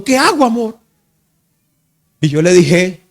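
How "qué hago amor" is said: imitating the crying wife, high-pitched; mock tearful, high helpless question; retold tearful plea. Frightened wife asking husband what to do, retold crying. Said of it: "me decía llorando".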